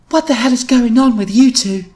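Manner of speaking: high, loud indignant question; angry demand. A narrator's reading. Louise stepping in between the two, angry demand. Louise confronting her arguing friends.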